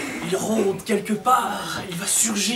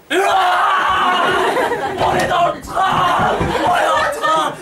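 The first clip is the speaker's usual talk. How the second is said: high, loud, slow cheering shout; excited scouts; 9 semitones higher, 5 dB louder, much slower than usual. Acted. Scouts bursting into the carriage, loud excited shout. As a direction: burst in cheering with the whole troop.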